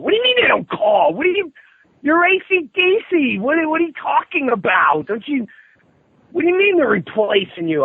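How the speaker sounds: a high mocking rant of outrage; incredulous outrage. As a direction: shout your disbelief at him. Retold wife's outrage continues.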